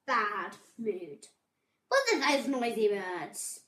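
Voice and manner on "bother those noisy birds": grumpy rabbit grumble, pushed out louder; grumpy muttering. A narrator's reading. Babbitty cross at noisy birds, grumpy muttering. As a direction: grumble crossly with some force. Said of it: "he muttered".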